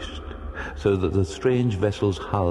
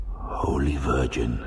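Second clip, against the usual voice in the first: slow, breathy whisper; barely a breath of awe; breathless; 5 semitones lower, slower than usual. Acted.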